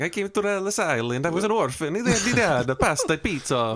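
playful pretend Italian accent; mock Italian accent. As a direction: ham up an Italian accent for a joke. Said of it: "full accent, everything".

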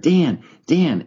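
high, swooping call of a name; retold calling out. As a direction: imitate someone calling a friend over. Pastor calling him over, retold.

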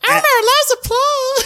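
very high cartoon falsetto; piping, squeaky mascot voice; Elmo impression gone Mickey Mouse. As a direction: squeak it like a kids' puppet. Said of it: "it's actually more Mickey Mouse".

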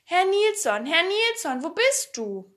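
high, loud searching call; Pipi. A narrator's reading. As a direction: call out for someone out of sight. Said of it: "schrie sie in der nächsten Minute mit lauter Stimme".

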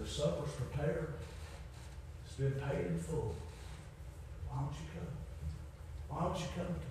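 very soft, hushed invitation; gentle pleading. Acted. Preacher voicing Jesus bidding the lost to come, gentle pleading. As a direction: drop right down and gently invite.

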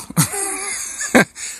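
high squealing burst of laughter. Real, not acted. Dad laughing at his daughter's predicament, high squeal. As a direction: crack up at the punchline.

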